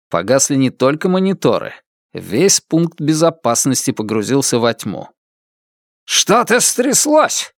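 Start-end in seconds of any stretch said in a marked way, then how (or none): 6.0-7.5 high, slow barked alarm. Acted. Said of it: "рявкнул братец Лун".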